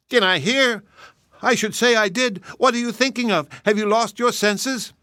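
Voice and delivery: high, exasperated little wife; wife scolding her husband over his boast. Acted. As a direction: scold him as the alarmed wife.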